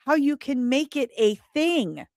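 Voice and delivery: ordinary delivery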